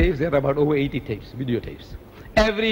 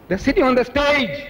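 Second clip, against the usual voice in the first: high, emphatic cry; impassioned exclamation; 8 semitones higher than usual. Retold or imitated. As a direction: drive it home with a raised voice. Driving home his retold protest.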